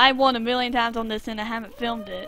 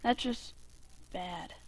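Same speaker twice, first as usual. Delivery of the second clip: ordinary talk, nothing marked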